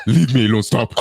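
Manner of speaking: laughing, teasing "leave me alone, stop"; coy half-laughing protest, not meant; playful fake no. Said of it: "The way she says it".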